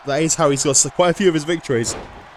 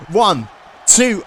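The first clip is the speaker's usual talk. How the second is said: shouted count; 6 semitones higher, 4 dB louder than usual. Real, not acted. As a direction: count yelled higher and louder. Calling the pin count.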